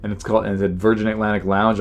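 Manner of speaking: normal talking voice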